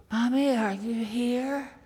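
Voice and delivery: usual speaking voice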